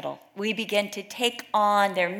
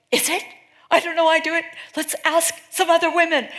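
excited; 9 semitones higher, 6 dB louder, faster than usual. Retold or imitated. Women answering, excited.

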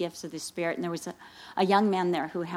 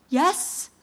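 shaky nervous; 7 semitones higher, 6 dB louder than usual. Retold or imitated. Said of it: "he's shaking".

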